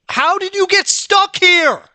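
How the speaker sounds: very high, very loud, slow scream; furious scream. Real, not acted. Gamer screaming at an object stuck behind the TV, high furious shriek.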